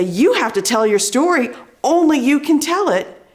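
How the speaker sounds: loud, high, emphatic stage delivery; confident, commanding. Shown as a demonstration. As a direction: step up and own the stage with conviction. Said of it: "If I step up and I use the stage".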